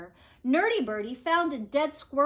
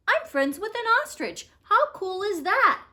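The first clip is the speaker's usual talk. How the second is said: excited Nerdy Birdie; 10 semitones higher, 5 dB louder than usual. Acted. Birdie brags about ostrich friend.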